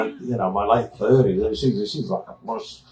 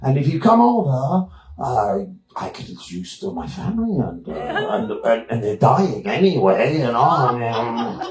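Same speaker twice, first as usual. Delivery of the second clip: mock Austrian woman. Long Babsy speech, Austrian voice.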